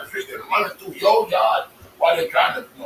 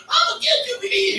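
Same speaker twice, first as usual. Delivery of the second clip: mock threatening lady. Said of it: "she said".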